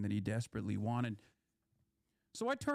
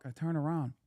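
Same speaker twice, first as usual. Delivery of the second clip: ordinary talk, nothing marked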